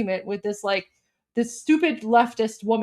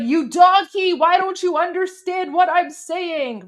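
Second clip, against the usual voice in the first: higher and louder, imitating a frustrated shout; mock angry yell; 7 semitones higher, 5 dB louder than usual. Activist yelling at the woman, imitated angry shout. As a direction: yell it at her like he's fed up. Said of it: "he starts yelling".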